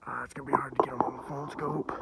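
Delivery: quick real whisper. Real, not acted. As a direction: whisper so the deer won't hear. Whispering near the deer.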